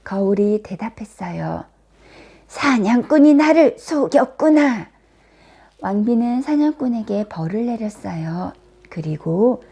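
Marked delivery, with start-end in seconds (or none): 2.5-4.9 loud, raised-pitch indignation; theatrical storybook fury; outraged queen. A narrator's reading. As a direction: exclaim as the deceived queen. Queen learns she was deceived.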